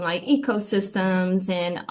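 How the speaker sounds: ordinary delivery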